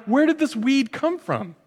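high, baffled question; puzzled surprise. Retold or imitated. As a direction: ask like you just spotted it. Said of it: "I'm like".

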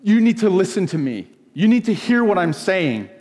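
imitated self-important thundering. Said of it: "thundering down".

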